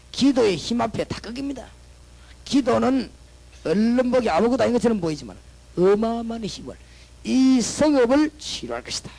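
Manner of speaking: loud, rising climactic preaching; full-force preaching. Acted. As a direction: build it to a triumphant climax. Climax of sermon. (절정으로 치닫는 힘찬 설교)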